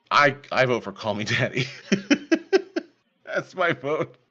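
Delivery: giggling through the vote; high, breaking into laughter; laughing. Real, not acted. Votes for silly clip, laughing.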